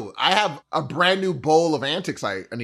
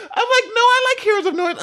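mock squeaky reply. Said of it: "I'm like".

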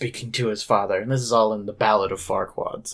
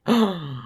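mock gasp; 6 semitones higher than usual.

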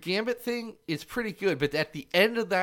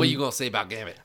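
low mock Cajun accent; Gambit; 6 semitones lower, much faster than usual. Teasing the co-host as Gambit, bad Cajun accent. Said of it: "He has the world's worst accent".

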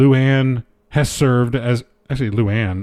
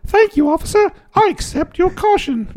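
meek polite voice. Retold or imitated. Replying to officer in voice.

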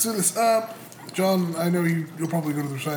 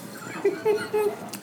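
squeaky giggle; high giggle; 4 dB quieter than usual. Real, not acted. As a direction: giggle helplessly. Player laughs at roast.

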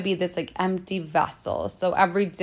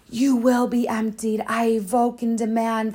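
slow, eerie commanding entity voice, a bit higher; dark entity voice; 3 semitones higher than usual. Retold or imitated. The entity demanding detox from her body, slow eerie command. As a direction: demand it like a spirit possessing you. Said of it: "like this".

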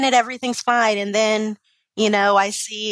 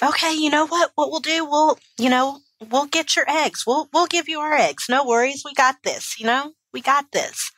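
higher-pitched, imitating supportive friends; rally a friend as they did; retold eager reassurance; 6 semitones higher than usual. Acting out her supportive best friends.

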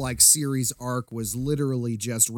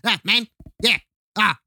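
higher-pitched South African parody; mock the South African accent, pitched up; 6 semitones higher than usual. Mocking the movie villains' unthreatening talk, South African accent. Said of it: "every time they say it".

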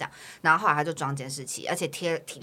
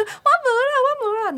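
high, flustered denial; flustered granny; 7 dB louder than usual. Retold or imitated. Old granny caught dumping trash, high flustered denial. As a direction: deny it like a caught old lady.

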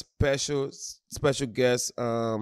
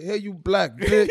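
higher, louder, slow insulting shout; mock fan yelling; 7 semitones higher, 6 dB louder, slower than usual. Fan cursing him out on his birthday, loud mock yell. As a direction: roast him at the top of your lungs.

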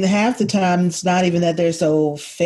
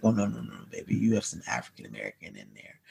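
hushed aside; 11 semitones lower, 12 dB quieter, faster than usual. Retold or imitated. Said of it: "I was like".

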